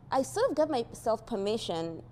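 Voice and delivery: usual speaking voice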